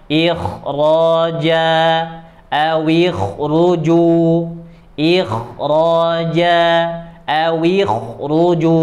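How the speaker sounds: ikhraja, ikhruju with a thick kh, drawn out; heavy ikhraja. Shown as a demonstration. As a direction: say ikhraja slow and full. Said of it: "Harus dibaca tebal". (ikhraja dibaca tebal)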